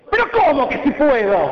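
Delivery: indignant Jesus. Acted. Jesus rebuking the father's doubt, indignant. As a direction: fire back in disbelief. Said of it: "se enojó y se calentó Jesús".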